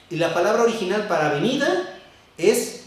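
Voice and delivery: usual speaking voice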